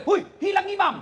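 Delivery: high-pitched imitated cry of alarm; alarmed shout. Boy lifting his head from prostration finds no imam, mock shout. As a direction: yell it out as a startled boy would. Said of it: "Langsung teriak".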